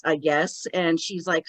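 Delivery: usual speaking voice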